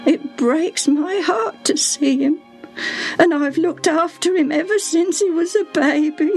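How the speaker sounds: high, sorrowful servant woman near tears; voice breaking. A narrator's reading. Servant telling of the sick boy, voice breaking. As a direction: let the grief catch in her throat. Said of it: "Her voice broke down".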